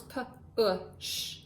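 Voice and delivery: p, u, sh each sound spoken separately. Shown as a demonstration. Teacher sounding out push for second graders, sound by sound. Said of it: "has the three sounds".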